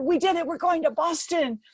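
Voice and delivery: retold shout of victory; jubilant. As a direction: burst out with joy at finishing. Hugging coach after the run.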